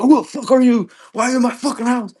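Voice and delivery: high, loud, fast frantic yelling; panicked yell. Retold or imitated. Tripping man yelling at his girlfriend he doesn't recognise, terrified yell. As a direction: bark it at an intruder you can't recognise.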